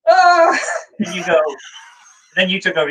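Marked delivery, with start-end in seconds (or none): 0.1-0.6 high, loud panicked shriek; startled shriek. Retold or imitated. Startled shriek as his hero approaches. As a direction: yelp in sheer panic. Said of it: "I'm going like".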